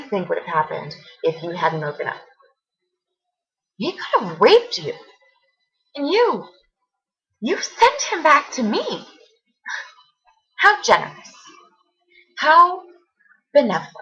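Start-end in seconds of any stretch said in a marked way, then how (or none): 3.8-9.1 high, indignant and emphatic; outraged accusation. Acted. Accusing friend of sending attacker to her, outraged. Confronting friend over the assault. 10.6-13.9 high-pitched mock praise; bitter sarcasm. Thanking friend for sending him, bitterly sarcastic.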